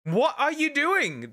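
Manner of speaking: very high, drawn-out disbelief; incredulous scolding. Real, not acted. Streamer asking YouTube what it is doing, incredulous scolding. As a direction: stretch the question out in a high, appalled voice.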